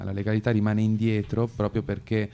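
ordinary delivery

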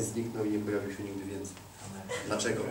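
ordinary talk, nothing marked

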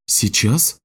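taken-aback question, rising at the end; mild bewilderment. A narrator's reading. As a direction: ask it with understated confusion. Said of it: "Женщина растерялась".

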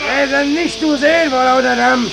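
slow loud high shout. Acted. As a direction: yell over the steam. Shouting over noise. (laut schreiend)

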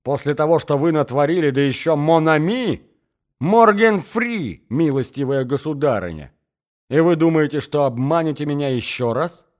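loud, high-pitched indignation; furious tirade. Acted. Cheated suitor rages at the scheming mother, furious. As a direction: rail at her at full voice. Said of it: "вскричал он в бешенстве".

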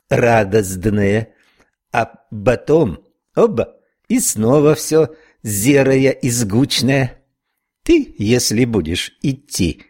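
slow drawling Irish brogue; unhurried Irish snail explaining; Irish accent. Acted. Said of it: "ирландским прононсом протянула". (ирландский акцент, протяжно)

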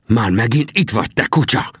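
hissed insult. A narrator's reading. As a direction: spit it out with tense venom.

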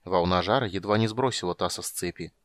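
ordinary delivery

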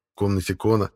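ordinary delivery

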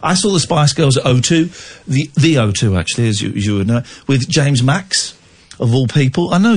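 normal talking voice

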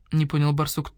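plain reading voice

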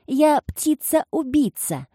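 high, slow mocking shout; shouting child. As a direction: crow like a shrieking boy. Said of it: "кричал он".

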